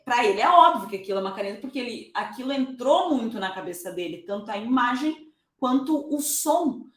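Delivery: ordinary delivery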